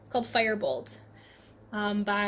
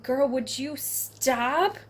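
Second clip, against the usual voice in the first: exasperated; 3 semitones higher, much slower than usual. Retold or imitated. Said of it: "I'm just like".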